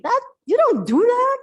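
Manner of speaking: high mock warning voice; imitating a doubting colleague; friends objecting. Translator mimics friends warning her, high and mocking. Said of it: "would say to me".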